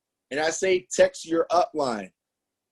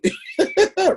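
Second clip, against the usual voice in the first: a burst of much higher laughter; 5 dB louder than usual. Real, not acted. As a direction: laugh at your own story. Laughs at his own story.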